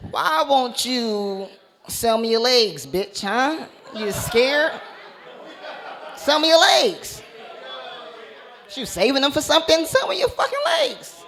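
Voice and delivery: hammed-up badgering, drawn out; mock demand. Acting out demanding a stranger's legs.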